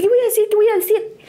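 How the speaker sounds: high, hurried comic dread; mock panic. Woman unsure how to answer the proposal, high mock panic. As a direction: repeat it high like flustered panic.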